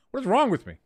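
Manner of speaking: ordinary talk, nothing marked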